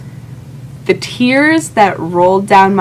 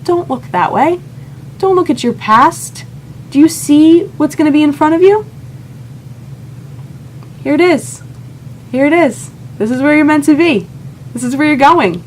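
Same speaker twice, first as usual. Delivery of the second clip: higher, lightly voiced gentle divine guidance; voice of God; 8 semitones higher than usual. God telling the heartbroken narrator to stop looking back, lightly voiced and high. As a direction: speak as God nudging her forward, kindly. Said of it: "it was God telling me".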